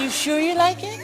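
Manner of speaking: lightly voiced Michael, high and teasing. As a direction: coax him like a gentle Michael. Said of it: "He said".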